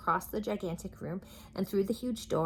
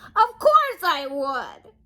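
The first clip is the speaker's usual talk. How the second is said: much higher, loud, slow wail; anguished cry; 11 semitones higher, 11 dB louder, much slower than usual. Acted. King wailing that he wants to be happy. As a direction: wail the words out. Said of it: "cried the king".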